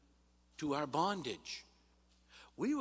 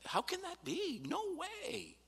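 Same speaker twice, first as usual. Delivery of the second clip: incredulous exclamation. Acted. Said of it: "he says, he says".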